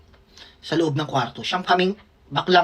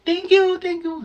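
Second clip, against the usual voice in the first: noisy thanks; 12 semitones higher, slower than usual. Retold or imitated. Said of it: "Pero maingay".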